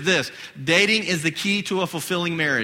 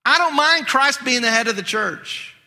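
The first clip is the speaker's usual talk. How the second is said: usual speaking voice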